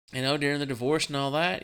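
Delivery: ordinary talk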